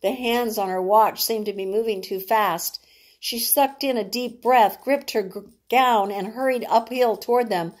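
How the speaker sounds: ordinary delivery, nothing marked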